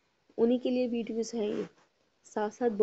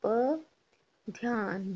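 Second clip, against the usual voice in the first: drawn-out syllable for dictation; slow teacher dictation; slow spelling; much slower than usual. Shown as a demonstration. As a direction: stretch the word slowly so kids can write it. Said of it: "बिंदी लगाना है".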